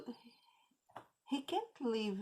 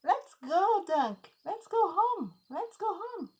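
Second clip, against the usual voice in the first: put-on high child's voice calling out; a small boy urging his donkey, high and bright; little boy; 12 semitones higher, 6 dB louder than usual. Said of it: "and the boy said".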